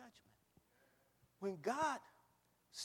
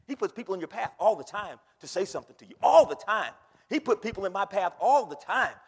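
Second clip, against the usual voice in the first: loud preaching; 4 semitones higher, 18 dB louder than usual. Acted.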